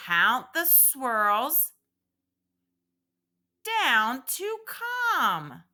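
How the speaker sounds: very slow, spaced-out recital with long pauses; nice and slow. Shown as a demonstration. Teacher reciting the hand poem slowly for children to repeat after her. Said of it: "I will say it nice and slow".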